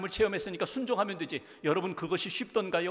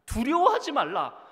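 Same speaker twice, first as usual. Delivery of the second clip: 두려워하지 말라 cried out higher and louder; 두려워하지 말라를 높고 크게 외치듯; loud proclamation; 8 semitones higher, 9 dB louder than usual. Shown as a demonstration. (두려워하지 말라, 회중에게 힘차게 선포)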